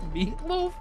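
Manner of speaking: squeaked up high in disbelief; incredulous. Real, not acted. Repeats the answer in disbelief.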